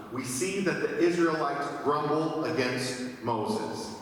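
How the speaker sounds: ordinary talk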